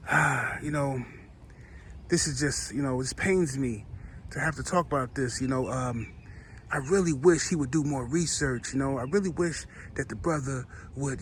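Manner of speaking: hushed fake reluctance to criticize; mock pained sympathy. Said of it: "But when it's one of them other folks, he say".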